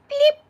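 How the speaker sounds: short, high, loud electronic bleep; imitated scanner bleep. Showing the machine scanning a can, imitated bleep. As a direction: beep like a scanner.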